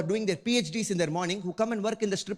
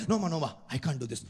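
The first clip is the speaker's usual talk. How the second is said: lower, hurried, a hint of an Indian uncle; fast nervous refusal; 6 semitones lower, faster than usual. Indian man turning away an older stripper, fast nervous refusal. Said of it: "refusing again".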